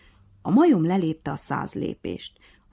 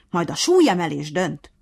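roaring; 8 dB louder than usual. A narrator's reading. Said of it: "ordította".

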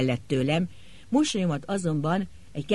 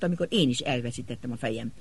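usual speaking voice

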